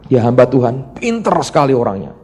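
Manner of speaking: ordinary talk, nothing marked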